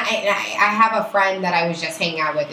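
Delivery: ordinary talk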